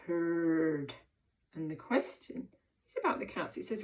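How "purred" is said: "purred" drawn out low like a cat; purring. Shown as a demonstration. Teacher reading with children, purring like a cat. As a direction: roll the word out low and long. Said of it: "in a meowing and purring sort of way".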